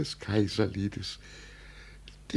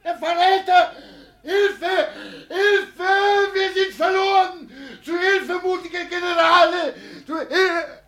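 frantic high shrieks for help, much higher and louder; panicked screaming; 12 dB louder than usual. Acted. Deluded old father screams to his generals for help in terror. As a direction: scream for rescue as if the enemy has come.

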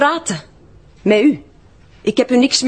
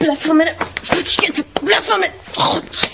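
high, sharp protest, pulling away; high frightened outburst, refusing to be touched; panicked protest; 8 semitones higher than usual. Acted. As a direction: push him off, voice jumping up high. Resisting having her arm checked.

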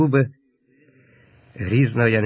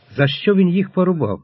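higher-pitched, pained wondering; a touch of anguish, pitched up; anguished question; 6 semitones higher than usual. A narrator's reading. Ataman recalls the slain boys, anguished question.

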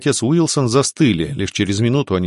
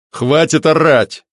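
slow, raised commanding voice; loud command; 6 semitones higher, 4 dB louder, slower than usual. A narrator's reading. Officer silences the yelling Alfred, loud command. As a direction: cut over the din, drawn out. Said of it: "перекрыл ор громкий голос".

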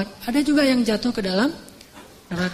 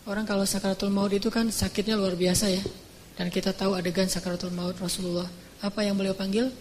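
ordinary talk, nothing marked

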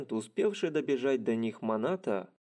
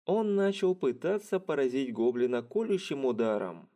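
ordinary delivery, nothing marked

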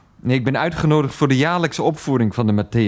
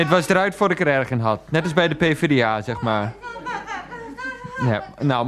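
normal talking voice

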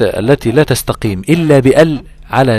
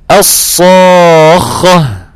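very slow, loud, higher chanted الصاخة; recited demo; 7 semitones higher, 10 dB louder, much slower than usual. Shown as a demonstration. Teacher recites the example so the student hears the difference. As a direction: stretch الصاخة long, chanting it. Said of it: "في السماع يختلف".

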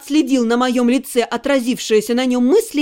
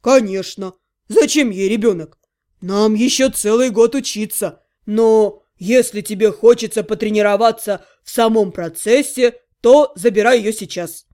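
lowered half-gnome voice; deadpan Otto; 5 semitones lower than usual. Acted. Half-gnome answering the necromancer about a baby, low deadpan. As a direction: back her up in a low voice, then tease. Otto confirms, then teases.

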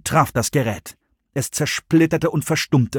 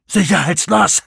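breathless gasp, a bit higher; panting Russ; 6 semitones higher, 4 dB louder than usual. A narrator's reading. Russ winded after the sprint, panting. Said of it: "keuchte Russ".

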